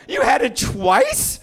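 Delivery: high, loud, drawn-out disbelief; hammed-up incredulous kid shout; shocked son. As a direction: squeal it as an astonished child. Son shocked by parents' answer.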